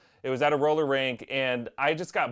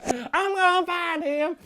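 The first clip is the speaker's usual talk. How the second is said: high, slow mock menacing voice; waiting bully kid; slower than usual. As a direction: vow revenge as the lurking kid. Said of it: "he's still out there".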